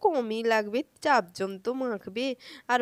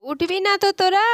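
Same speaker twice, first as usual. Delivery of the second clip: higher, louder scolding demand; exasperated shout; 10 semitones higher, 9 dB louder than usual. Acted. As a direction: challenge children who refuse to get up. Aunt losing patience with children. (বিরক্ত হয়ে চেঁচিয়ে ধমক)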